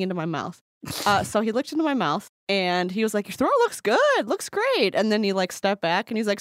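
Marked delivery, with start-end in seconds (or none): 3.3-4.9 high, singsong doctor's verdict; goofball doctor. Retold or imitated. Doctor reassuring patient about throat, high goofball imitation. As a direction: imitate a breezy doctor in a much higher voice.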